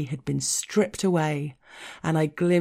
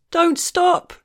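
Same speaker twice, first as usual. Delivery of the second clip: high, loud desperate plea from a man; a lover's panicked high cry of don't stop; anguished horror; 7 dB louder than usual. Acted. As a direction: beg him not to stop in horror. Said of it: "with a kind of anguished horror".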